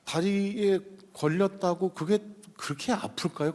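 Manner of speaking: usual speaking voice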